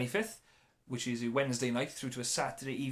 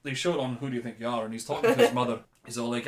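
ordinary talk

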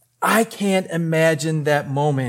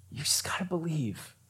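hushed, intimate encouragement; whispered reassurance; 2 semitones lower, 13 dB quieter than usual. Acted. Jesus urging the grieving father on, whispered. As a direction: whisper it to someone who needs hope.

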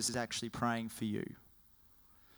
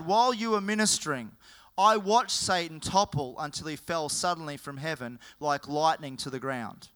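proclaimed quote; 2 semitones higher, 4 dB louder than usual. Acted. Pastor reading out Jesus' words in a loud proclaiming voice. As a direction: announce it like a preacher's proclamation.

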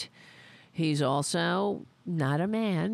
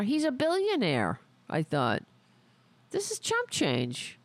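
ordinary delivery

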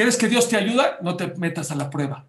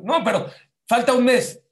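high, raised-voice protest; protesting objection; 6 semitones higher, 5 dB louder than usual. Retold or imitated. Worried parent answering the rabbi, protesting. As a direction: object back, pitch jumping up.

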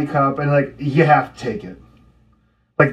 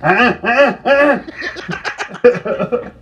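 scolding; 9 semitones higher than usual. Pretend. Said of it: "I'd be like".

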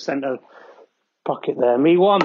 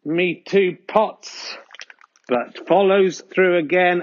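usual speaking voice

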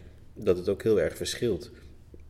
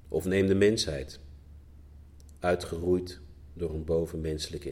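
measured poem recital with long pauses; deliberate, spaced-out verse reading; poetry reading. Real, not acted. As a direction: read your poem line by line, letting each one land. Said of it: "je gedicht nog een keer willen lezen".

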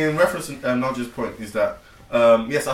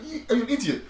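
laughing; 12 semitones higher, faster than usual. Retold or imitated. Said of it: "I look at them like".

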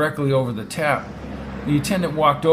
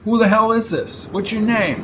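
higher-pitched, indignant challenge; angry demand; 7 semitones higher, 3 dB louder than usual. Acted. Brady confronting the operator, angry.

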